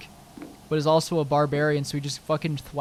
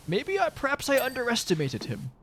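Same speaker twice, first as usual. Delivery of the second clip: a pitched-up, mildly surprised NPC musing; lightly voiced NPC reconsidering, higher; impressed NPC voice; 8 semitones higher, 3 dB quieter than usual.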